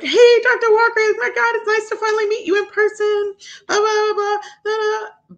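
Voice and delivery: very high, loud mock-gushing squeal; gushing greeting. Biopsy staff finally meeting Doctor Walker, gushing.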